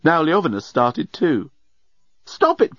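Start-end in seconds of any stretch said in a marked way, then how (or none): 2.2-2.8 high-pitched urgent cry; exasperated cry. A narrator's reading. Nettie shaking the giggling men, exasperated cry. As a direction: cry out high to stop their nonsense. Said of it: "cried Nettie".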